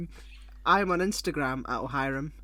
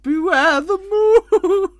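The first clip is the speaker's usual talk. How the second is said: huge, drawn-out theatrical cry; hammed-up vicar's warning; 18 dB louder, much slower than usual. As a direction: bellow a slow, high warning. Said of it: "the vicar was like".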